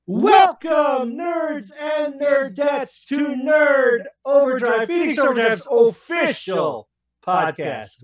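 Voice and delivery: booming show-host intro, high and loud; announcer voice. Acted. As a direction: hype up the crowd like a ring announcer. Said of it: "announcer voice".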